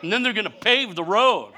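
normal talking voice

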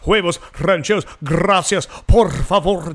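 imitating dad, long trills in "rancheros, gracias, por favor"; rolled R's. Dad showing off to the waiter, overdone trilled R's in "rancheros, gracias, por favor". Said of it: "rolling your R's".

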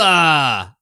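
ordinary delivery

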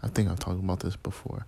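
normal talking voice

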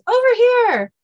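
high, loud comic dog call. Acted. Kennel dog calling Dean over, hollering.

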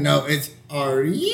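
YOU stretched out long in a mock name correction; wrong and weird. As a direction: spell out "are you" slowly as a weird name. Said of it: "pronounce it wrong and weird".

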